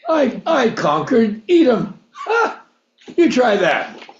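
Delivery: mock arrogant boast with a scoffing laugh; hammed-up swagger, high and laughing; boastful brag. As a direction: crow like a proud conqueror. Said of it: "and basically say".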